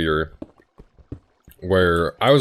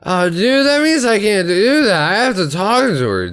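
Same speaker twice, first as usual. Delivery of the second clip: put-on much higher and louder drunken begging; drunk pleading; 7 dB louder than usual. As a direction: whine like a wasted friend who won't let it go. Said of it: "completely plastered at this point".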